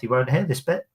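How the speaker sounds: ordinary talk, nothing marked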